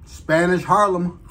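talking between bites; chewing. Real, not acted. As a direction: mouth partly full of food. Seen 1: Bites at -3, talks holding burger, bites again from 4.8.

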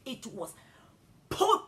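usual speaking voice

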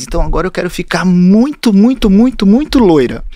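mock-eager, stretch out the muitos; eager client. Client telling the hairdresser she wants to be very blonde, eager. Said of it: "E aí ela me contou".

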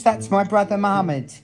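ordinary talk

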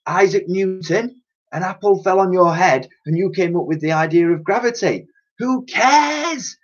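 high, swelling mad-scientist boast; megalomaniac professor rant. Acted. Old professor dreaming of fame, rising mad gloating. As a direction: gloat grander with every name.

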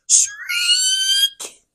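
high breathless whispered owl screech; owl shriek. Acted. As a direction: hiss out a thin owl shriek.

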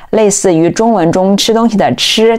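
ordinary talk

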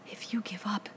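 hushed, breathless whisper of dismay; appalled whisper. Acted. Piggy to Ralph in the dark, appalled whisper. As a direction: whisper it as if the idea is unthinkable. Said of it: "said Piggy in an appalled whisper".

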